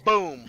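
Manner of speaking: loud, sudden boom; explosion noise. Acted. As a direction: punch it out like a blast. Game master imitates flashbang blast.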